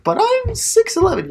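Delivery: high pleading mock voice; whiny tall guy. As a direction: whine it like a spoiled giant. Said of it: "be like".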